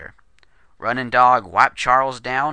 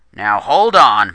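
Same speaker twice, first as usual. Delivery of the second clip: much louder, slow, warning; forceful interruption; 10 dB louder, slower than usual. A narrator's reading. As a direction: plant your feet and stop him cold. Murphy confronts the stranger.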